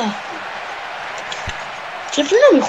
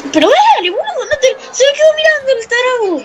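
high-pitched, wailing exasperation; exasperated complaint; 8 semitones higher than usual. Real, not acted. Gamer scolding a player who stood watching, exasperated. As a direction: berate your idle player at a high pitch.

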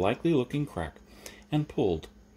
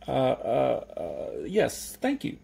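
halting, then jumping high in pitch; flustered hesitation; 4 semitones higher, much slower than usual. Acted. Jeremy answering the milkman, flustered and hesitant. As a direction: stammer politely before giving in.

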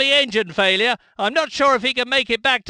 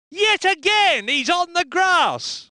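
exasperated commentary; 7 semitones higher than usual. Acted. Car spins onto grass again.

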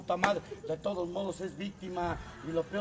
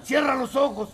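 mock command; 4 semitones higher, 7 dB louder than usual. Mock guided exercise.

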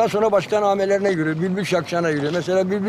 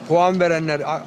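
ordinary talk

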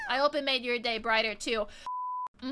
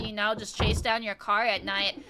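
ordinary talk, nothing marked

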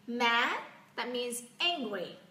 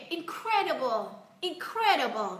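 higher-pitched, showing real relish; delighted demo; 4 semitones higher, 4 dB louder than usual. Shown as a demonstration. Teacher showing learners how to enjoy the word, delighted. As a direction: show you love it. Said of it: "thể hiện gọi là mình rất là thích thú".

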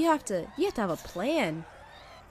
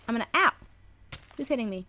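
ordinary delivery

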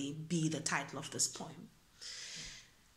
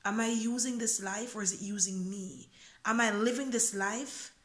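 spoken word poem; 4 semitones higher, 4 dB louder than usual. Acted. Poet reciting a freestyle poem to viewers, earnest spoken word. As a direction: perform the freestyle poem to your viewers, rising in feeling.

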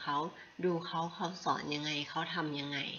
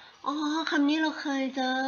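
higher, louder, slow "oh, I've seen it"; surprised recognition; 9 semitones higher, 8 dB louder than usual. Retold or imitated. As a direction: play the friend's delighted aha moment. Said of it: "เพื่อนก็".

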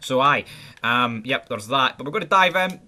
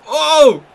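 sharp, much higher and louder "oh" at a near miss; sudden gasp-shout; 13 semitones higher, 12 dB louder than usual. Real, not acted. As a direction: jump at the chance. Near goal, sudden cry.

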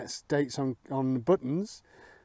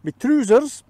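a rubbish mock Scottish accent, higher and louder; hammed-up Scottish voice, higher and much louder; 7 semitones higher, 10 dB louder than usual. Detectorist quoting Scottish friends while rubbing the find, mock Scottish accent. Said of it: "as our Caledonian friends would say".

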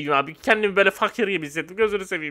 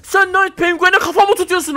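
higher, louder mock fury, slowed down; mock angry yell; 8 semitones higher, 5 dB louder than usual. Taunting a player who challenged the penguin, mock angry yell. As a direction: yell at him like a picked-on bully. Trash-talking rival player.

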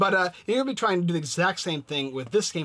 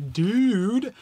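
excited dude; 7 semitones higher, 7 dB louder than usual. Real, not acted. Exclaims game name.

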